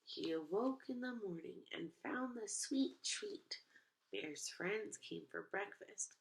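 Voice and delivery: put-on lively read-aloud to kids; expressive picture book narration; storybook reading. A narrator's reading. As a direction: read it like storytime for little ones.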